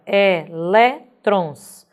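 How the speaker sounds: "elétrons" drawn out in syllables, strong LÉ; stress on LÉ. Shown as a demonstration. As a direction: slowly separate e-LÉ-trons. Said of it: "vai ser acentuado lé". ("elétrons" silabado devagar, tônica em LÉ)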